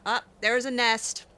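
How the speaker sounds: ordinary delivery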